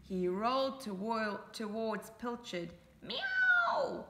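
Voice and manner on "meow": high, loud meow; loud high cat meow. Acted. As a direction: squeal it like a cross cat. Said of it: "said Pilchard".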